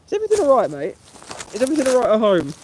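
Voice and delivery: high, loud mock-concerned questioning. Streamer turning on a troll, mock concern. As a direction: ask it in an exaggerated high voice.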